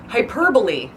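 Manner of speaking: four syllables in Hyperbole, stressed on PER, ending in lee; Hyperbole pronounced hy-PER-buh-lee; correct pronunciation. Shown as a demonstration. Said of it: "That's how it's actually pronounced".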